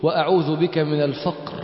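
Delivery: quiet earnest prayer, word by word; devout supplication. Acted. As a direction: recite the plea humbly for them to repeat. Leads the morning supplication.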